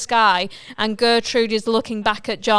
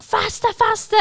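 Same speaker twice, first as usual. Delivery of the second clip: urging faster; 9 semitones higher than usual. Acted. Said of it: "John's like".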